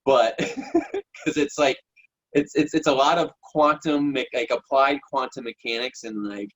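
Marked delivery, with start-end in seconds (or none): 0.4-1.0 brief high-pitched laugh; short chuckle. Real, not acted. Coach admitting they don't know how it works, short chuckle. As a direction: chuckle lightly to yourself.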